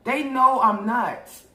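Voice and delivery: high, slow, drawn-out mock offence; mock offended. Retelling the cousin's slur, she hams up offended outrage. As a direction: play up being scandalized.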